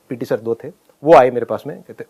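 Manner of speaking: ordinary talk, nothing marked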